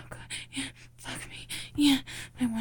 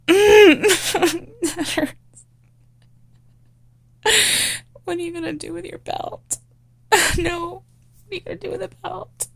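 much higher, loud pained cries; high pained moaning; 10 semitones higher, 9 dB louder than usual. Acted. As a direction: whimper and yelp as if hurt. Roleplay, high pained moans.

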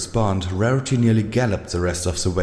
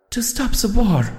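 high timid pony voice with resolve; small voice, firm resolve; resolute; 14 semitones higher, 3 dB louder than usual. A narrator's reading. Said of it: "a tone of resolve in her voice".

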